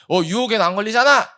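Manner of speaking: indignant protest. Retold or imitated. Preacher mimics complainers protesting to God. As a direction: insist like a sulking complainer. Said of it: "라고 막 불평해요".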